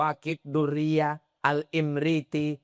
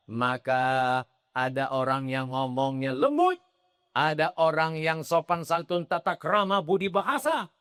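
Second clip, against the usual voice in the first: normal talking voice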